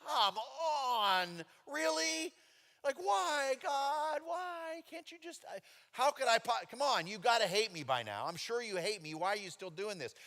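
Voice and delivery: high, loud exasperated whine at God. Retold or imitated. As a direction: plead with the sky, fed up. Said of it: "I'm like".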